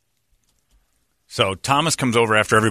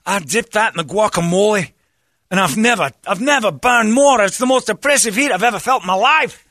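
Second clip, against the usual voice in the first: high, loud mock wail of a burnt friend; imitating Thomas in pain; 11 semitones higher, 4 dB louder than usual. Imitating friend Thomas wailing after eating wasabi, high mock anguish. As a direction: ham up the agony from the wasabi. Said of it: "crying, sobbing".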